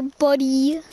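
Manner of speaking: normal talking voice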